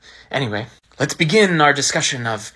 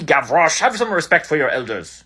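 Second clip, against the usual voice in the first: normal talking voice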